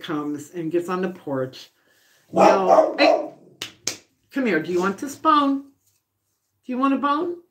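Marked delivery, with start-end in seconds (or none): 2.3-4.6 calling the dog. Real, not acted. 4.6-7.4 high coaxing voice for the dog; sing-song dog coaxing; pet talk. Real, not acted.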